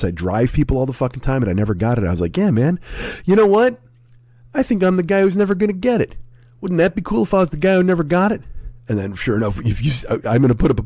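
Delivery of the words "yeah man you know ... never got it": high-pitched naive confidence; mock cocky. Host mimics his past self boasting of immunity, mock cocky. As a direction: imitate a pleased, self-kidding boast, pitched well up.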